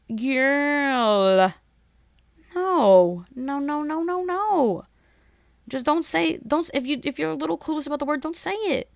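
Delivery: high, emphatic no no no; exasperated scolding. Real, not acted. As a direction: scold her, fed up. Scolding the contestant.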